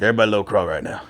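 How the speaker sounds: lightly voiced, mocking sergeant teasing recruits; mocking drill sergeant. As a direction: taunt them like little turtles. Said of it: "he's like".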